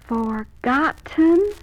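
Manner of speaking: "forgotten" said slowly, syllable by syllable; dictionary pronunciation. Shown as a demonstration. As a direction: stretch out each syllable of "forgotten". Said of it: "saying each syllable very distinctly".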